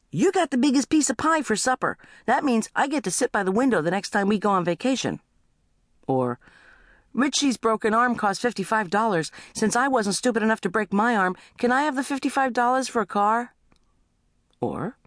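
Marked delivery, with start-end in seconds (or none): 0.1-5.2 put-on high-pitched complaining kid; squabbling kid. Kid claiming a fair share, high-pitched complaint. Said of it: "One will say". 7.1-13.5 put-on high-pitched kid making a case; bargaining kid. Kid asking a parent for money, high-pitched bargaining. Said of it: "One will say".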